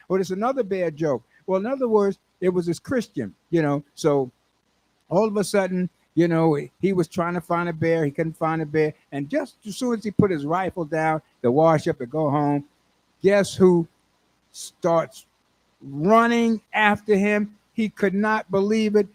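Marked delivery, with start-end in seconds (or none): none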